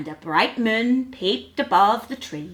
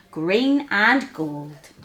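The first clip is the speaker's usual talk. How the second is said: plain reading voice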